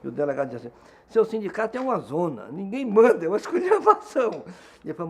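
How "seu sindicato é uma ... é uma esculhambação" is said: high, loud mock outburst; irritated. Police chief railing at the union president, mock irritated. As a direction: imitate an exasperated official, pitch climbing. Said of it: "o delegado ficou irritado".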